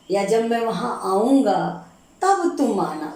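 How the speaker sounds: usual speaking voice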